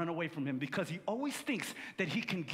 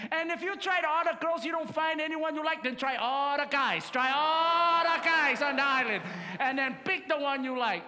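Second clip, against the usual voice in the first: boisterous uncle; 5 semitones higher, 5 dB louder than usual. Retold or imitated. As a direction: stretch it out like a tipsy uncle's grand advice. Uncle escalating advice.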